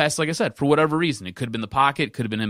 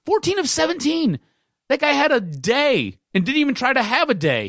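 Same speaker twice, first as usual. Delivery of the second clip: pissed off; 8 semitones higher than usual. Real, not acted. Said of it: "So mad about this game".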